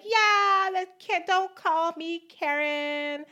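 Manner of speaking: high, whiny entitled woman; mock Karen voice. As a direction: whine like an offended Karen.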